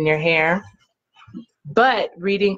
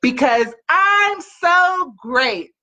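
excited affirmation; 7 semitones higher, much slower than usual. Acted. Mother leads affirmation chant.

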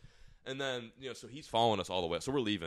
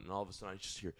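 normal talking voice

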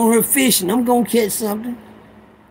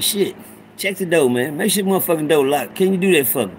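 ordinary talk, nothing marked

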